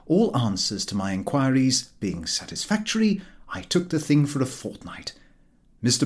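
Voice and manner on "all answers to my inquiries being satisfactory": usual speaking voice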